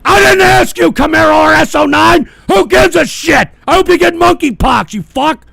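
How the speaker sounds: high, loud furious tirade; enraged shout. Real, not acted. Host berating a viewer who donated, enraged shout. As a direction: scream at the heckler, furious. Lashing out at a donor.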